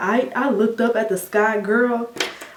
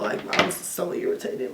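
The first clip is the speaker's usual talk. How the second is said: breathy whispered gripe under the breath; irritated whisper; 7 dB quieter than usual. Real, not acted. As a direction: whisper the annoyance as if confiding. Venting about surgery stress.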